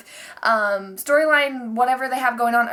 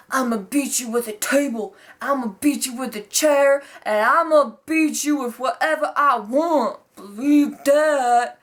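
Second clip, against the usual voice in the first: mock promo. Imitating Roman Reigns' promo.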